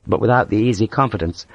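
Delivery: plain reading voice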